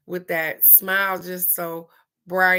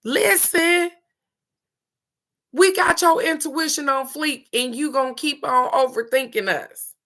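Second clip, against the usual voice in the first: higher, louder mock voice of the cards; voicing the cards; 8 semitones higher, 4 dB louder than usual. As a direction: speak as the cards scolding playfully. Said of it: "They said".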